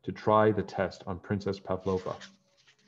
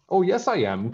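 higher, louder indignant protest; crying out; 9 semitones higher, 6 dB louder than usual. A narrator's reading. Princess Prunella protesting to the prince, crying out indignantly. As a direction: insist hotly, mock outrage. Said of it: "cried Princess Prunella".

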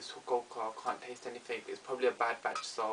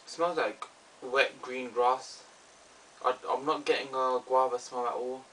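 cup held at mouth; muffled by cup rim; speaking into a cup; 5 dB louder than usual. Real, not acted. Seen 0: Sniffs cup at nose and mouth, lowering it briefly between sniffs.

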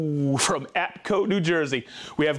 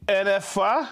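usual speaking voice

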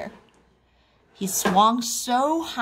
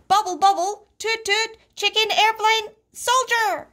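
very high, excited chant; swimming drill; 12 semitones higher, 3 dB louder than usual. A narrator's reading. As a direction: chant it all high, no let-up. Froggy recites the swimming drill.

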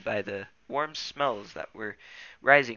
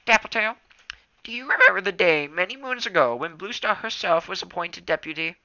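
put-on old tom cat voice; 8 semitones higher, 7 dB louder than usual. A narrator's reading. Elder reminiscing.

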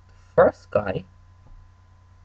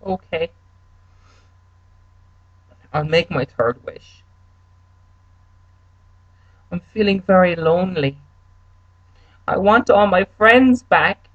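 higher, pleading voice of the lonely castaway; stranded man wishing his friends back, higher and pleading; lonely third guy; 7 semitones higher, 3 dB louder than usual. Acted. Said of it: "the third guy goes".

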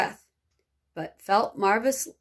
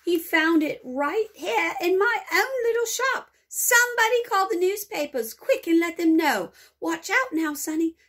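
excited; 8 semitones higher, 3 dB louder than usual. A narrator's reading. Shopkeeper's excited outburst.

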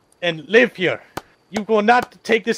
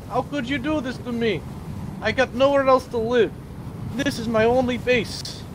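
ordinary delivery

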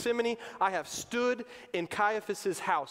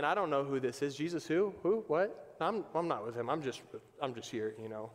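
mumbling, shrinking away, softer and lower; a cornered man trying to slip out of the question; nervous denial; 4 semitones lower, 3 dB quieter than usual. Acted. Peter at the fire, denying Jesus, sinking into a scared mumble.

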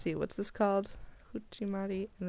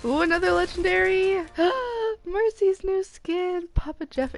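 excited; 11 semitones higher, 6 dB louder than usual. Real, not acted. Gets a legendary skin.

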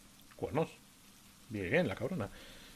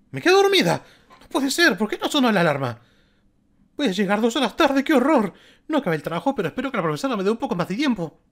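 high, loud frantic schoolgirl who overslept; 9 semitones higher, 8 dB louder than usual. Acted. Streamer voicing Kyoko waking late, high frantic shouting. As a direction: shriek it like you just woke late.

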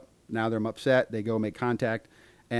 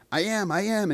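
high-pitched mock protest; imitated yelling back; 10 semitones higher, 3 dB louder than usual. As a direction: answer back like a defiant kid. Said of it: "she's yelling back at the mom".

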